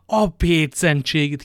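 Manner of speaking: hangosabb bosszús felkiáltás; kiáltsa fel dühösen; hangos káromkodás. A narrator's reading. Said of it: "kiáltott fel D'Artagnan". (hangosabb felkiáltás, bosszúsan)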